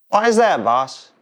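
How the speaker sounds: wide-eyed helper asks; eager young worker. Acted. Eager worker answers his boss.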